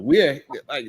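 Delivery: ordinary talk, nothing marked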